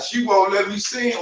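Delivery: high, soft mock complaint; mock crying. Imitating men who complain about custody, mock crying.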